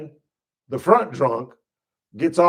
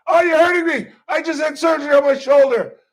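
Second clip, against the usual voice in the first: higher, loud whine of protest; whiny arrestee; 9 semitones higher, 5 dB louder, faster than usual. Retold or imitated. Arrestee whining at the cop while being cuffed. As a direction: complain like a hurt suspect.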